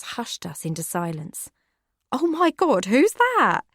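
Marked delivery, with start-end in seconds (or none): 2.1-3.6 much higher, louder alarmed cry; startled Becky. Acted. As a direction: jerk round in shock.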